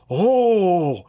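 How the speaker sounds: shocked crowd reaction, pitch jumping up; mock astonishment, drawn-out then high; acted freak-out. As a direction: mimic people reeling in disbelief. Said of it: "And they'd freak out".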